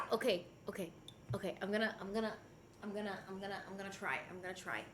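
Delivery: quiet, low mumbling to herself; hushed self-talk; soft murmur. Real, not acted. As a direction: mutter while concentrating. Concentrating, murmuring softly.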